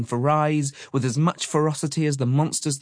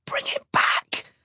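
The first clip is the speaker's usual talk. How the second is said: slow, forceful stage whisper; loud whisper; slower than usual. A narrator's reading. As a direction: whisper it as loud as you dare. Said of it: "whispered as loud as he dared".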